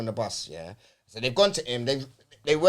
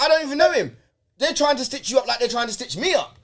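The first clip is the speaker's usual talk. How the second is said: higher, brisk mock denial; lightly voiced lad brushing him off; mimicking the other guy; 10 semitones higher, 5 dB louder than usual. Quoting the arrested man to friends, in a higher mock denial. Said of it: "He's like".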